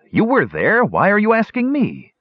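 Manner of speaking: high, flustered protest; rattled and defensive. Acted. Garth snaps back at Flint's questions, high flustered protest. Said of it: "angry and flustered".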